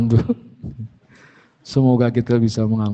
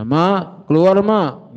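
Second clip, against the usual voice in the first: lightly acted call, pitched up; calling his wife; 7 semitones higher, 4 dB louder, much slower than usual. Husband just home asks his wife to come out, mild call. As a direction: call into the house, raised but not shouting. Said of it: "Begitu pulang panggil istrinya".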